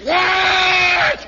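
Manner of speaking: very high, loud drawn-out yell; far-off shout. Acted. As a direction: holler back from down the street. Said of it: "you hear way off down on the company street".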